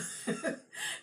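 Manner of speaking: a short airy laugh at the memory; breathy laugh. Real, not acted. Laughing at grandmother's ghost story, airy chuckle. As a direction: laugh off the strangeness.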